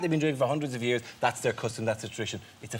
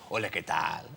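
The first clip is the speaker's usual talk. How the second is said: Spanish waiter; 7 semitones lower, 6 dB quieter than usual. Retold or imitated. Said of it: "waiter would walk up to me first and go".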